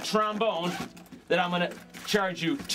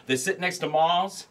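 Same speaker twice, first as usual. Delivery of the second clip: normal talking voice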